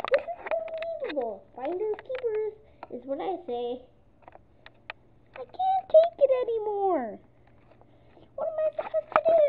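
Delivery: high, loud, fast exasperation; loud, high and frantic; frantic despair. Acted. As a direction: pigeon at its wit's end. Pigeon despairing.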